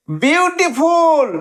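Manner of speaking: Beautiful stretched slowly in one joined, high-pitched run; whole word, drawn out. Shown as a demonstration. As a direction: say beautiful high and unbroken, lengthening it. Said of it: "তিনটে syllable". (Beautiful উঁচু সুরে, টেনে, না ভেঙে)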